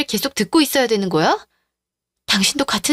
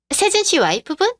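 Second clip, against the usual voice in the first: mock sweet surprise; 8 semitones higher than usual. Mistress feigns surprise.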